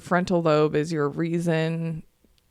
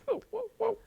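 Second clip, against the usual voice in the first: mock alarm; 7 dB quieter than usual. Retelling dodging spit.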